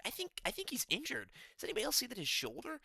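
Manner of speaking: high, mocking commentator voice; mocking Rogan. Viewer mimicking Joe Rogan's excuses in a high mock voice. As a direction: squeak it out like a fussy commentator. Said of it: "Rogan was like".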